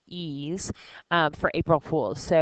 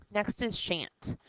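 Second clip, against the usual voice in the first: ordinary talk, nothing marked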